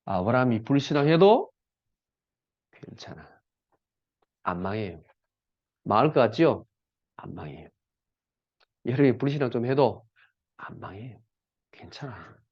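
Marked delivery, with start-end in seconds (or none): 2.7-12.4 reassuring. Real, not acted. Reassuring the congregation.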